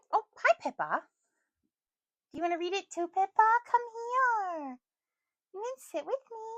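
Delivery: high, cooing voice to a pet; pet talk. Real, not acted. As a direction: coo at your little pet. Talking sweetly to her pet.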